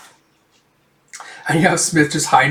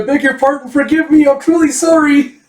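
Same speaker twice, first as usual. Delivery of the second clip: high, loud, frantic apologizing; shouted apology; 12 semitones higher, 5 dB louder than usual. Retold or imitated. Man shouts back sorry while running away, frantic. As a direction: cry out apologies at a very high pitch.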